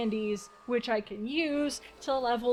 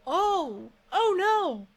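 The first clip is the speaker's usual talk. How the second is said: dismayed cry; 7 semitones higher, 5 dB louder, much slower than usual. Real, not acted. Game setback, sudden dismay.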